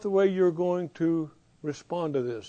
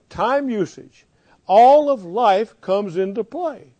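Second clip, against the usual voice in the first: ordinary delivery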